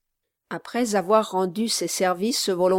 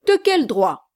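loud indignant cry; cried out; 3 semitones higher, 7 dB louder than usual. Acted. Gentleman crying out at the groom, indignant. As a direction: throw his words back at him. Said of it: "s'écria le gentleman".

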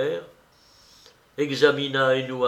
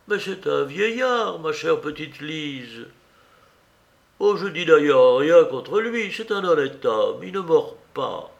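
put-on high, emphatic old aunt; exasperated aunt; 5 semitones higher, 3 dB louder than usual. Aunt arguing over her niece's love, agitated pleading. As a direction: plead and argue in a raised pitch. Aunt's figure in the story.

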